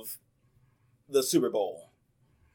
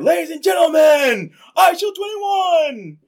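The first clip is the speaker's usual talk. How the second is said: high, loud stadium announcer, drawing out the name; hammed-up arena introduction; mock announcer shout; 10 dB louder, slower than usual. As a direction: belt it out like introducing a star player. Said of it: "And they're like".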